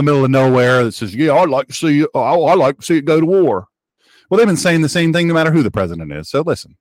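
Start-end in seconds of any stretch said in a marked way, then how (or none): 1.1-3.7 put-on wackadoo with a Southern accent. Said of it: "wackadoos always have a Southern accent".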